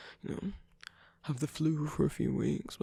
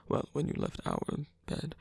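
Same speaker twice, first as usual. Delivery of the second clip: usual speaking voice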